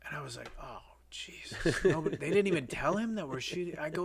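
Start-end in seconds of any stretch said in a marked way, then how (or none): 0.6-1.8 slow, breathed aside of dread; dismayed whisper. Retold or imitated. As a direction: whisper it to yourself, sinking. Retold dismayed aside to himself.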